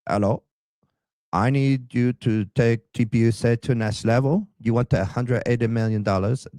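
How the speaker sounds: put-on a clumsy, half-hearted Israeli accent; attempted Israeli accent. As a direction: try an Israeli accent as Netanyahu, and miss. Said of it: "I don't know how to do a, an Israeli accent".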